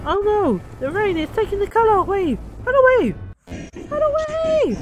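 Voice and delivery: very high, hammed-up alarm; mock panic. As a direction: cry out as if fleeing for your life. Fleeing the colour-stealing rain.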